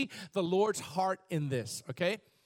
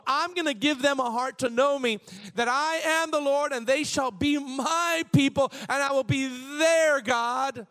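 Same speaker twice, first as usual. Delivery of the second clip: preaching at full force; 5 semitones higher, 4 dB louder than usual. Acted. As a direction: thunder the promise out to the church. Proclaims scripture with rising zeal.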